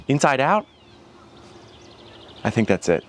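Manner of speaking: usual speaking voice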